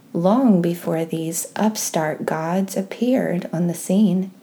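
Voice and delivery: ordinary talk, nothing marked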